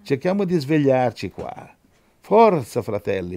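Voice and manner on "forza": high, loud exhortation; punchy wake-up call; rousing urging. Real, not acted. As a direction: spur the congregation on. Preacher rousing a sleepy congregation.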